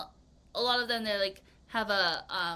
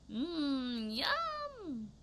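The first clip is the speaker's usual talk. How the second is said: savoring yum; 4 semitones higher, 4 dB quieter than usual. Pretend. Drooling over pizza.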